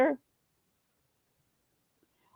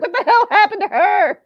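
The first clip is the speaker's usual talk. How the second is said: much higher, louder mocking voice, laughing through it; mock baffled driver; 12 semitones higher, 6 dB louder than usual. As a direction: squeak out a stunned other driver's words. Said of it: "He's probably like".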